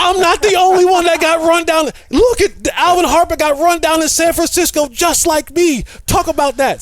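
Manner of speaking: high, loud vindicated cheer; triumphant shout. Retold or imitated. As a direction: shout it out, finally not alone. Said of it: "So I'm like".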